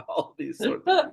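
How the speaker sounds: high laughing voice, giggling through the words. Real, not acted. Guest laughs at old family superstitions, laughing.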